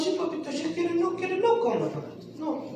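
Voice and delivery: soft, doting mock mother; coddling parent. Preacher mimics a doting mother, soft and coddling. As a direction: coo over the spoiled son.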